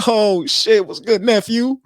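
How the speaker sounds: loud, high mock drunk uncle greeting. Uncle greeting his nephew, loud high hammed-up drunk voice. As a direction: boom it out like a tipsy uncle at the door. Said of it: "He was like".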